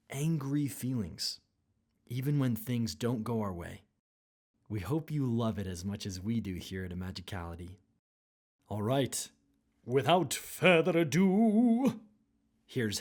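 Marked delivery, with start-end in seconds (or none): none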